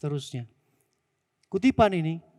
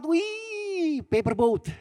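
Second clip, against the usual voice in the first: put-on high, delighted child's voice; a kid thrilled with his new paper boat; squealing little child; excited little boy; 6 semitones higher, slower than usual. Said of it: "Tuh anaknya seneng banget".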